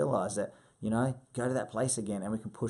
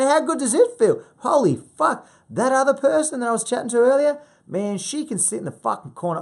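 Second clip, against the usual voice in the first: loud, high, hammed-up pep talk; ecstatic hype; 12 semitones higher, 9 dB louder than usual. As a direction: shout it like a fired-up motivational coach. Acted coach hyping up the client.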